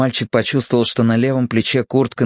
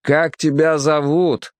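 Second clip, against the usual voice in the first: higher, drawn-out call, mild in volume; captain calling out; 5 semitones higher, slower than usual. A narrator's reading. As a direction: call slowly after someone leaving. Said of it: "крикнул капитан". (капитан кричит вдогонку, протяжно)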